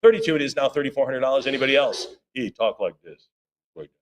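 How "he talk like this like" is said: low, softer TV host voice; mild imitation of a soul show host; Don Cornelius low voice. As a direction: drop into a smooth, low host voice. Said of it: "yeah, low voice".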